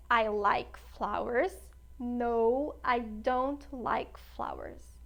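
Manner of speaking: slow, clear full answers; walk the class through both answers slowly; slow classroom demo; slow model answers. Shown as a demonstration. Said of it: "teacher models full answers".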